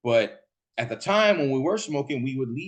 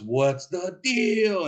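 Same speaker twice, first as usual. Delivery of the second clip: high, slow, drawn-out mock yell; high-pitched imitation holler; imitated screaming; 7 semitones higher, much slower than usual. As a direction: stretch it into a wailing catchphrase. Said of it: "didn't know why he's screaming".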